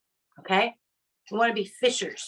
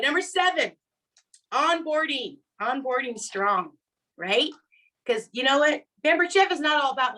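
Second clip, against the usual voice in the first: ordinary talk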